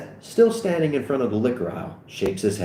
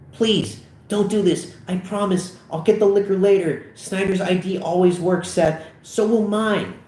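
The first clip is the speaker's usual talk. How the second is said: high-pitched anxious begging; desperate pleading; 9 semitones higher than usual. Acted. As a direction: plead urgently with a friend. Begs friend not to steal.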